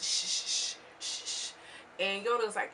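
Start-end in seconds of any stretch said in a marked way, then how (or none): 0.0-1.5 repeated voiceless shushing; imitated hushing. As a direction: shush someone who won't listen. Imitating New York hushing Yodeler.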